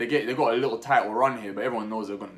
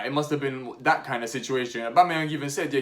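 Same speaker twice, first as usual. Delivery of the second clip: usual speaking voice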